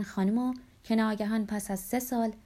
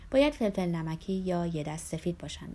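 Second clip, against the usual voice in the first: ordinary delivery, nothing marked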